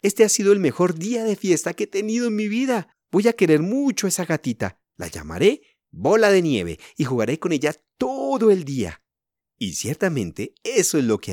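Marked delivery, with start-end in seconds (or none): 0.0-9.0 put-on higher, gushing child voice; thrilled girl pitched up; delighted little girl. A narrator's reading. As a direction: bubble over with happiness. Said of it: "exclamó Elsie encantada".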